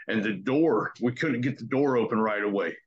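usual speaking voice

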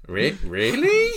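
high, slow, stretched-out "really?"; mock disbelief. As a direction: act stunned and unconvinced. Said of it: "I was like".

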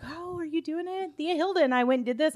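mock eager kid voice; imitating niece. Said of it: "or my niece was like".

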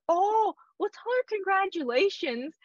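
high, effusive retold well-wishing; imitating an overjoyed congratulator; delighted congratulations. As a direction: gush it, pitch leaping way up. Acts out a man congratulating her.

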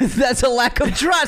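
high, drawn-out mock shout; mock outrage. Mock indignant accusation, very high.

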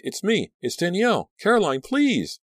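very high, frantic pleading; loud, desperate high-pitched begging; terrified shriek. A narrator's reading. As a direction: shriek to be recognised. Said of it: "Danielle shrieked".

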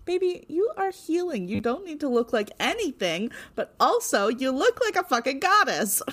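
high, gushing adoration; gushing fan. Real, not acted. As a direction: swoon over her like a fan. Gushing at the character Skye.

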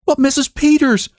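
calls out in shock to the sheriff's wife; horrified cry. Acted. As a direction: cry out in dawning horror. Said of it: "cried Missus Hale".

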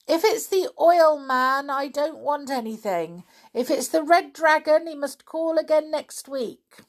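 high, loud call from inside; shouting through door. Acted. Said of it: "The voice shouted again".

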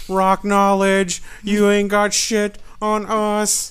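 slow, high, loud trash talk; wrestler promo shout. Acted. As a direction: bellow it like a heel wrestler.

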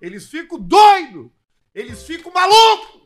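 hammed-up ad read. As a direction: sell it like a TV spot. Mock ad read for sponsor.

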